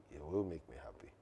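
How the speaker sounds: very low, near-whispered answer; subdued reply. Retold or imitated. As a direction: drop to a quiet murmur, acting out the reply. Acts out own subdued reply to wife.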